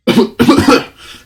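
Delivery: sudden high hacking cough; loud hacking cough. Acted. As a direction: hack it out loudly. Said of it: "spice stuck in my throat".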